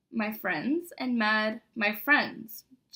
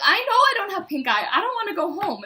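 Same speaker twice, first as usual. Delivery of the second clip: higher, louder indignant protest; 8 semitones higher, 8 dB louder than usual. Retold or imitated. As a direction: insist you don't have it. Protesting to the nurse.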